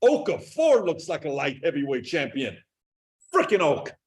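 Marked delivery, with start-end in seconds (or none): none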